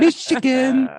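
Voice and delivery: high, loud triumphant reveal; Michigan! belted out with glee; excited. Real, not acted. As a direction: announce it like a prize. Announcing destination with glee.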